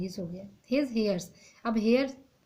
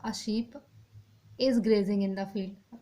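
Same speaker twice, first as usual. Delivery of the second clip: slow read-out; much slower than usual. Shown as a demonstration. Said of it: "singular होता तो यहाँ पर अ होता".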